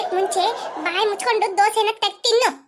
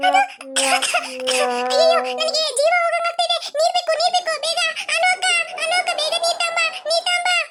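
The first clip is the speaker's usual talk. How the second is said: high-pitched frantic plea for water; panicky, high-pitched begging; urgent crying out; 8 semitones higher than usual. Acted. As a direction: cry out high, as if choking. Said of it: "ಯಾಕೆ ಈ ತರ ಕೂಗ್ತಾ ಇದೀಯಾ".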